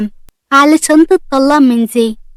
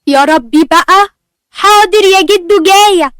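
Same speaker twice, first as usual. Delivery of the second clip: calling back; 6 semitones higher, 5 dB louder than usual. Acted. Answering grandpa from afar.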